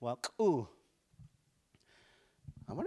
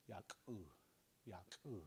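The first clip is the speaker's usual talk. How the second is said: much softer, relaxed "Yak, ooh"; calm, relaxed; 5 semitones lower, 18 dB quieter than usual. Shown as a demonstration. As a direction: let "Yak, ooh" flow calmly without effort. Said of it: "you can just calm down".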